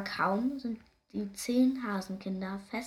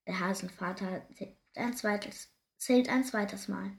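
plain reading voice

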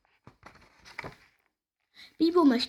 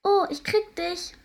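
high, slow gleeful squeal; playful squeal; 8 semitones higher than usual. A narrator's reading. Fairy teases her friend, high gleeful squeal. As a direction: squeal it out in play. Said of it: "kreischt Edbarinchen ausgelassen".

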